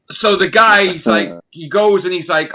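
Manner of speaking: ordinary delivery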